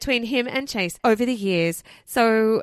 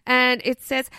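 ordinary talk

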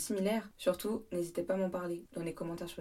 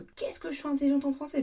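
exasperated outburst; 8 semitones higher, 6 dB louder than usual. Real, not acted. Bragging about her French.

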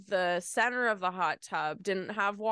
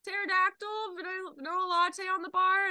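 high, sing-song order call; barista calling out; 11 semitones higher than usual. Retold or imitated. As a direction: call the drink out across the counter.

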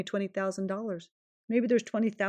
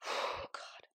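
breathy sigh; 11 dB quieter than usual. Real, not acted. Emotional sigh after message.

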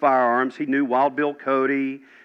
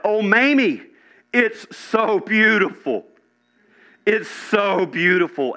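high, drawn-out cry of wonder; retold awed cry; 8 semitones higher, 3 dB louder, slower than usual. Dying great-great-uncle to Mamie about his vision, crying out in wonder. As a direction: imitate a dying man crying out at a beautiful vision. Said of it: "He cried out".

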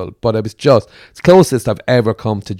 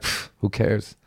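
softer, scoffing who-cares; dismissive shrug; 7 dB quieter, slower than usual. Retold or imitated. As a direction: brush it off with a pff. Not caring at bad gigs.

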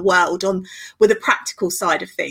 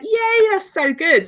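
excited yay; 9 semitones higher, slower than usual. Retold or imitated. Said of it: "And I was like".

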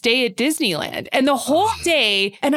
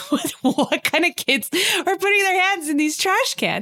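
incredulous; 6 semitones higher than usual. Retold or imitated. Disbelief at the guard.

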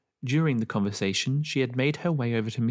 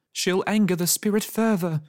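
raised, anxious neighbour voice; fretful old neighbour; 8 semitones higher, 4 dB louder than usual. A narrator's reading. Neighbour warning the parents, anxious raised voice. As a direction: worry aloud about the spirit. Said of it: "said Mister Tash".

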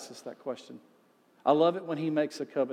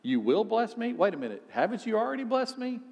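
rising, incredulous questions as Isaac; a puzzled Isaac double-checking God's promise; Isaac surprised; 6 semitones higher than usual. Retold or imitated. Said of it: "Isaac has to think back".

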